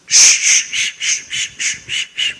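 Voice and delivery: car wash swish. Retold or imitated. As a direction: mimic the brushes of a car wash. Imitating robot car wash brushes.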